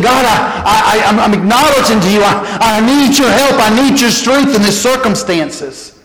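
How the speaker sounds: humble cry for help; pleading prayer. Acted. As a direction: beg God for strength, halting. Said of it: "it's saying".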